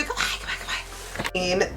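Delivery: soft unvoiced goodbye; whispered goodbyes. Real, not acted. Signing off the vlog, a fading whisper.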